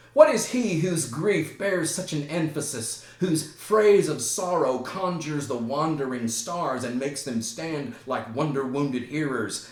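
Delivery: loud, high theatrical challenge; defiant proclamation. Acted. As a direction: announce yourself like a tragic hero. Hamlet steps forward in challenge.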